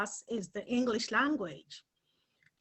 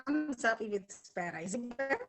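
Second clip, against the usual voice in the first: breaking up audio. Real, not acted. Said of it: "your audio is breaking up".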